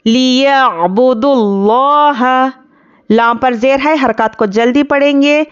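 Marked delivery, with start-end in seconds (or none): 0.1-2.3 slow, melodic tajweed recitation; measured Quranic chant. Shown as a demonstration. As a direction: chant it slowly like a qari. Recites verse fragment.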